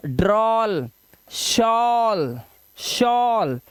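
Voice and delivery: drawn-out demo words; model pronunciation. Shown as a demonstration. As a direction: say each word slowly with a long vowel. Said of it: "pronounce करेंगे".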